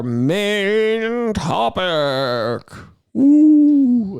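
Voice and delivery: drawn-out spooky announcer voice; slow spooky build into a ghostly ooh. Pretend. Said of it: "I do like to talk in a spooky voice".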